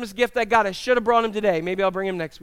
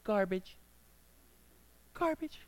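prim nice word; 14 dB quieter than usual. Retold or imitated. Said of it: "we make it nice. We say".

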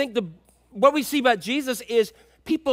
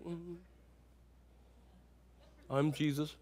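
low, hushed mock-serene voice; mellow movie Jesus; 6 semitones lower, 15 dB quieter than usual. Preacher imitating how films depict Jesus, mellow murmur. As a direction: murmur it slowly with long pauses. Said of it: "he talks like this".

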